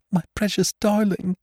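high, wailing cry of a terrified mother. Acted. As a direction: wail for the trapped child. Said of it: "wailed the mother".

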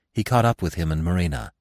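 ordinary delivery

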